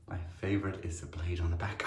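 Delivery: soft whispered aside; whispering. Real, not acted. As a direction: murmur it under your breath. Aside while shaving.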